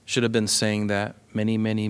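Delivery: ordinary talk, nothing marked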